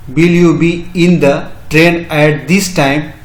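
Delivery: very slow model question; slow teaching read. Shown as a demonstration. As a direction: drag out every word for learners. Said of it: "English में कैसे इसका बनेगा?".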